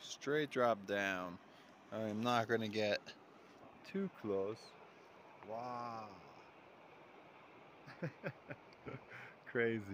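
ordinary talk

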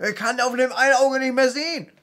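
high, loud complaining shout; angry. Retold or imitated. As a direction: yell it like an angry man who lost the fight. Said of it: "Und er war so sauer".

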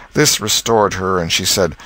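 ordinary delivery, nothing marked

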